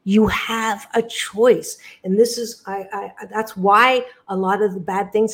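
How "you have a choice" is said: "You have a choice" drawn out very slowly, each word weighted; slow way down and lean on "You have a choice"; slow emphatic repeat. Shown as a demonstration. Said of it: "repeats the phrase for emphasis".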